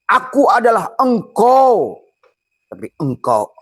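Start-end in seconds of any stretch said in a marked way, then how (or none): 0.1-2.0 much higher, louder and slower; a high, drawn-out voice for the rice. Acted. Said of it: "Kata nasi yang sudah jadi beras". (suara nasi tinggi dan lambat)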